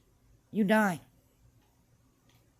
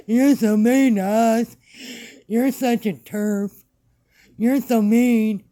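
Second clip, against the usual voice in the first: louder, slow whining mockery of a critic; whiny mock complaint; 3 semitones higher, 8 dB louder, slower than usual. Mocking a critic's video, a loud slow whine. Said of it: "you gonna be like".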